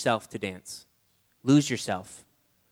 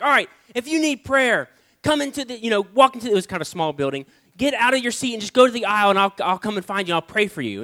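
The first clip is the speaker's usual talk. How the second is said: preacher's altar call; 5 semitones higher, 5 dB louder than usual. Retold or imitated. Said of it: "He's like".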